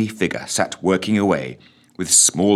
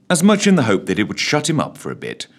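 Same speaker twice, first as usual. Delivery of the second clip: plain reading voice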